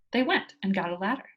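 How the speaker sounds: usual speaking voice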